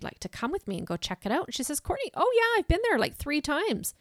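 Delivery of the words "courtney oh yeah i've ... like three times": high, loud and gushing; excited girlfriend. Retold or imitated. Girlfriend eagerly tells Courtney she's been there, high and gushing. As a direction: gush like an eager friend who's been before.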